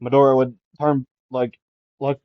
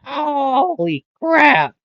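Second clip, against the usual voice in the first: high, drawn-out holy crap; high slow gasp of shock; shocked cry; 13 semitones higher, much slower than usual. Retold or imitated. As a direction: stretch it out in pained disbelief. Midoriya shocked by the punch.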